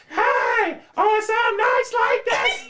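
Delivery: high, loud squealing shout; screaming fan. Retold or imitated. Fan shrieking at the concert. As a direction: squeal at the stage. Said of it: "I'm like".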